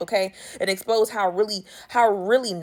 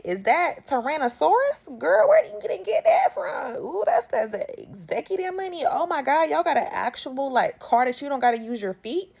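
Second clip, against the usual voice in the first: much higher, crying out in awe; mock amazement; 5 semitones higher than usual. Barney's family gawking at Wilma's leather, high mock awe. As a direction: gasp over the rich outfit.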